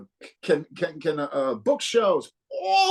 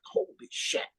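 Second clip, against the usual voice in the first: awed whisper; 7 dB quieter than usual. Retold or imitated. Amazed at the studio recording.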